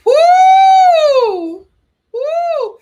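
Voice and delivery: high, loud celebratory whoop; a loud cheering whoop; cheering woo. Real, not acted. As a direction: let out a big whoop. Cheering shout.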